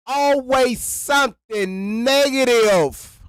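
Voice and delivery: slow, high, fed-up drag on always; exasperated. Real, not acted. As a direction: stretch it out in disbelief. Ranting about her videos.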